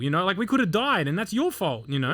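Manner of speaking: high-pitched, indignant retold rant; angry protest. Trainee to officer after near crash, angry. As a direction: re-enact telling off a superior.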